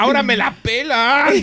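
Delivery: high loud gloat; gloating shout. Pretend. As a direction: crow it over everyone. Gloating as imagined new millionaire.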